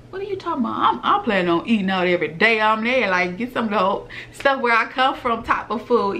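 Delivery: high and laughing, fast, amused disbelief; laughing reply. Retold or imitated. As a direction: laugh through it like the idea is absurd. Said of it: "And it's like".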